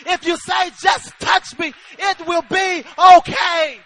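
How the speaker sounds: loud, driving preacher's shout; ringing, forceful declaration, slowing at the end; God's emphatic promise. Acted. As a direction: proclaim it with building force. Voicing God speaking, preaching at full force.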